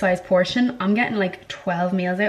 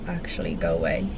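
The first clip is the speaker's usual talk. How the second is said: mock dismissal; 6 dB quieter than usual. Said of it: "I'm like".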